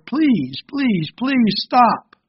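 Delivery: high, drawn-out pleading, not shouted; crying out. Acted. Teacup choking on paint fumes, pleading with the potter in high cries. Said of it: "I cried out to the master potter".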